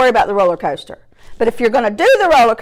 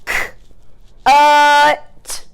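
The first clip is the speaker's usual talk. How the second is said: "K-, uh, t" in separate sounds, UH held higher; chopped word; 4 semitones higher, much slower than usual. Shown as a demonstration. Teacher showing pupils the chops, sounds split apart. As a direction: chop CUT sound by sound. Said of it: "Let's chop the word cut".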